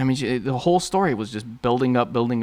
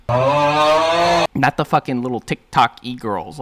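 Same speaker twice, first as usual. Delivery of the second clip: mocking drawl; 3 semitones higher, 5 dB louder, much slower than usual. Real, not acted. Mocking the imagined audience.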